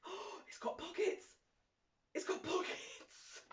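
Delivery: high, breathless thrill over pockets; delighted gasp. Real, not acted. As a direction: gasp with joy at a find. Discovers pockets in dress.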